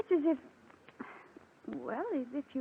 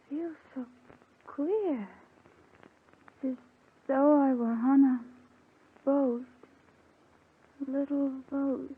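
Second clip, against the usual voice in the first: drugged, drifting words with long gaps; drowsy. Acted. Rose succumbing to the sedative, drowsy. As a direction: let each phrase float off woozily. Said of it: "Feel drowsy already".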